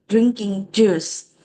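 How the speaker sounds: ordinary talk, nothing marked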